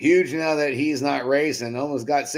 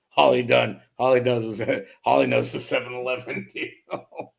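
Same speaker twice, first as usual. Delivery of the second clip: usual speaking voice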